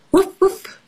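high, airy demo woofs; light playful puppy woof; dog noise. Shown as a demonstration. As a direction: show children how a dog barks. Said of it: "what noise does the dog make".

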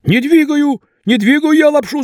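high, frantic denial; panicked young man. A narrator's reading. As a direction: cry out a denial in fright. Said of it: "закричал Вася".

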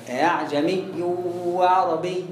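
low recited "أعجمي وعربي" with no madd on the first vowel; eased hamza, unlengthened. Shown as a demonstration. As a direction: recite "أعجمي وعربي" softening the second hamza, without stretching it. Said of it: "مفيش مد".

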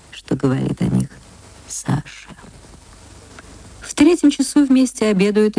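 plain reading voice

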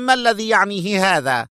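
a nervous, rising question. A narrator's reading. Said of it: "قائلا في عصبية".

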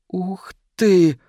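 put-on high-pitched wonder of a little boy; boy's amazed delight. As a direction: marvel like a kid given new toys. Boy amazed by new toys.

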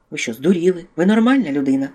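angry hiss. A narrator's reading. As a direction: furiously stop him in his tracks. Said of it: "просичав він".

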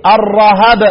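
الرهب as ar-rahab; "ar-RA-hab" for الرهب; fatha on both letters. Shown as a demonstration. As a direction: say الرهب with short a on ر and on ه. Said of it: "هرتبها هكذا، أقول إيه؟".

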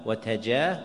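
"وتجاه" with a after the ta; fatha on the ta. Shown as a demonstration. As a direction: say "وتجاه" as wa-tajah. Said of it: "بالضم والفتح".

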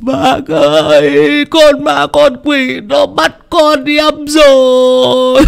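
high, slow, loud sobbing howl; howling wail. Acted. Fat Toàn wailing to old Hà, howling in grief. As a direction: howl it out in despair. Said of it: "rú lên".

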